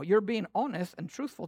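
ordinary delivery

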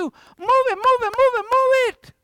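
loud, high yelled orders; drill-sergeant shout. Retold or imitated. Preacher urging Christians on like a yelling sergeant. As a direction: yell it like a sergeant pushing recruits. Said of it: "This is what Peter is saying".

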